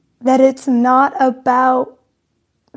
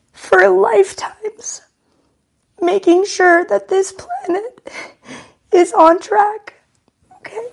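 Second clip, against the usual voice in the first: pitched up and choked with real tears; fighting back tears as she speaks; tearful; 5 semitones higher than usual. Real, not acted. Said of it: "Ugly crying".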